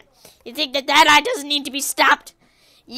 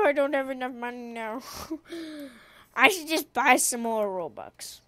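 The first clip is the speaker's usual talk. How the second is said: ordinary talk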